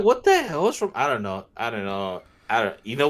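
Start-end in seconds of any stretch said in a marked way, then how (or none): 0.0-0.9 startled retort. Retold or imitated. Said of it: "I'm like".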